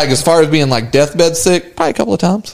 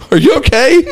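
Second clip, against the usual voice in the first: high-pitched imitation of a worried partner; concerned wife; 9 semitones higher than usual. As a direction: check on him in a higher, acted voice. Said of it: "to make sure I'm okay".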